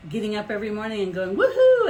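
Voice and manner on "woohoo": loud, high whoop of delight; joyful whoop. Retold or imitated. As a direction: cheer like you can't wait for the day. Joyful wake-up shout each morning.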